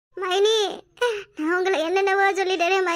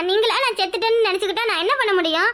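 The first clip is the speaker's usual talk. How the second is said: ordinary talk, nothing marked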